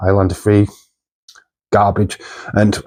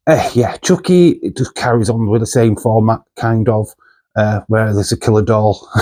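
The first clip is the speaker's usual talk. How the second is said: ordinary delivery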